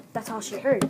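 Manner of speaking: usual speaking voice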